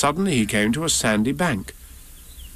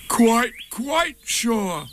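high, very slow, certain bear voice; Pooh; 11 semitones higher, much slower than usual. A narrator's reading. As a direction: insist on it, drawing out each word. Pooh insists who he is.

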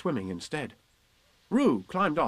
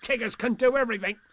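raised, swaggering voice with a "ha!"; boastful Tigger; 11 semitones higher, 3 dB louder than usual. Acted. Tigger boasts to little Roo, high swagger. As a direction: brag it out high and bouncy.

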